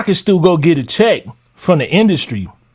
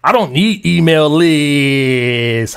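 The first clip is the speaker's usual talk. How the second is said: slow, drawn-out mock quote; mocking slow read; 5 semitones lower, much slower than usual. Quoting the commenter back slowly in mockery. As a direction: draw it out like a taunt. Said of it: "Ha, ha".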